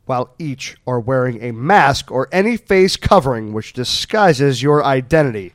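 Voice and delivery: ordinary delivery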